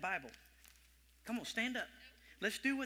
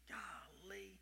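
awed whisper; 11 dB quieter than usual. Real, not acted. Preacher marvelling before the congregation, awed whisper. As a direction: breathe it in wonder.

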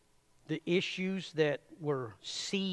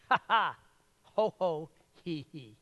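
mock laugh exercise; 3 dB louder than usual. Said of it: "He would go".

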